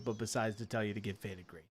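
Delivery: usual speaking voice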